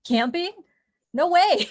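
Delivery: imitating appalled city people, high and loud; loud, rising disbelief, then a hammed-up no; mock incredulous refusal. Voicing city people dreading camping.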